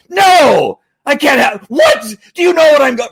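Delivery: high, loud angry yell; acted-out dad's furious outburst; losing your cool. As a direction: explode at the kid who interrupts you. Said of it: "you can lose your cool".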